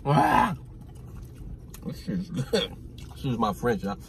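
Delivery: savoring between bites; talking while chewing. Real, not acted. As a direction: mouth full of burger. Seen 0: Reclines with burger overhead, then sits up visibly chewing while talking.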